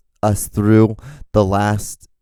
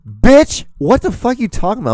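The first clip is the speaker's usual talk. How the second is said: sudden high yell, much higher and louder; angry retort; 7 dB louder than usual. Retold or imitated. Retorting to the offended customer, loud mock yell. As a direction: snap back at the accuser, hot and loud. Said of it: "I'm like".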